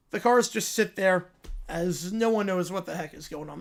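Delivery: ordinary delivery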